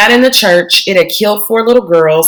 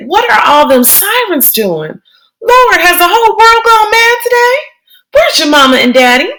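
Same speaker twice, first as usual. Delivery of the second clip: put-on much higher, exclaiming old woman; an old woman crying out to the Lord; startled grandma voice, higher; alarmed grandmother; 12 semitones higher than usual.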